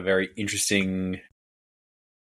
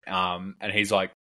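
ordinary delivery

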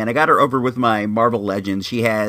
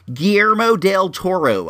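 Guillermo del Toro in a put-on accent, pitched up; 5 semitones higher than usual. Shown as a demonstration. Host showing his easier way, accented Guillermo del Toro. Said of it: "I'll say it like that".

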